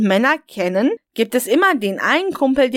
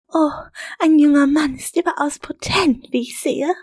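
higher, lightly voiced teasing woman; teasing woman's voice; 5 semitones higher than usual. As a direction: tease him with a knowing tone. Mother speaks to young lover.

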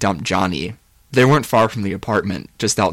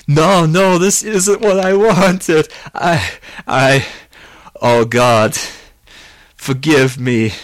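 loud, high anguished wail; wail, then stumble brokenly to a plea; horrified, stammering remorse; words catching in throat; 7 semitones higher, 7 dB louder, slower than usual. A narrator's reading. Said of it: "his words catching in his throat".

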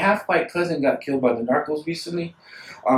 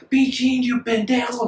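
high-pitched imitation of bus hecklers; jeering passengers; 8 semitones higher than usual. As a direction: mimic a busload jeering, voice raised in pitch.

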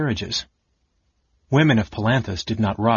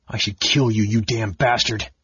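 choked voice. A narrator's reading. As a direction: force the words past a tight throat. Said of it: "he said in a choked voice".

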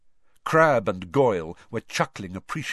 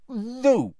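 dignified; 5 dB louder than usual. A narrator's reading. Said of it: "with as much dignity as she could muster".

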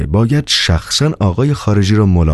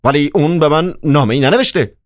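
higher-pitched, puzzled protest; astonished; 8 semitones higher than usual. A narrator's reading. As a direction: object in amazement. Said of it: "با حیرت گفت".

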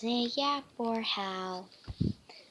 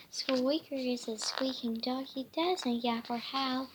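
usual speaking voice

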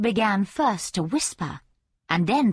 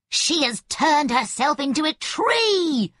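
screaming charlatan; 6 semitones higher than usual. A narrator's reading. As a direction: shriek it in panic. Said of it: "screamed the charlatan".

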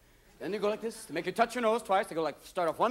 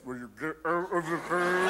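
slow mock cop; 4 semitones lower, slower than usual. Cop walks up to driver.

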